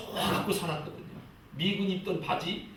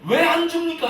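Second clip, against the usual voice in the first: loud, high-pitched mock grievance; complaining prayer; 10 semitones higher, 7 dB louder than usual. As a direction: act out someone demanding answers from God. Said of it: "항상 하나님 앞에 원망 불평하고". (큰 소리로 불평하는 기도 흉내)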